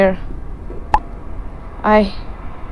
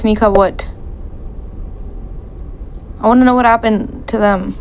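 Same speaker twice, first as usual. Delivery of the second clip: usual speaking voice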